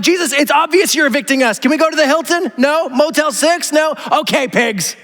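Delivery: high, haggling demon; mock demon bargaining. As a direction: haggle down to the pigs. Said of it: "I'm like".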